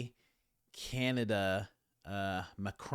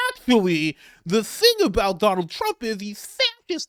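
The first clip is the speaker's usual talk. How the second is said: high, loud sneering liberal voice; mock liberal voice; 11 semitones higher, 11 dB louder than usual. Mocking how liberals sound to him, high and loud. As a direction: squeak it out high like a know-it-all. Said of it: "they sound like".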